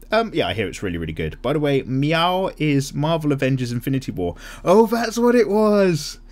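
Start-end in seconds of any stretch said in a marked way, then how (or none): 4.6-6.2 surprised realisation. Real, not acted. Realises the name.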